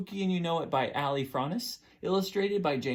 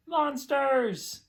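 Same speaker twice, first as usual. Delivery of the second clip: dramatic reveal; 11 semitones higher, 5 dB louder than usual. Acted. Dramatic reveal after pause.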